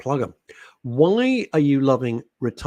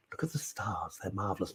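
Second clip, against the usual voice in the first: hushed, awed whisper of a science presenter; soft awed whisper about the stars; whispered Brian Cox impression; 3 semitones lower, 13 dB quieter than usual. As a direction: breathe it like marveling at the night sky. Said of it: "Brian Cox".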